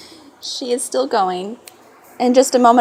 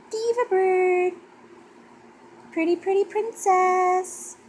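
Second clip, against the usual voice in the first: cooing pet voice; 5 semitones higher than usual. Real, not acted.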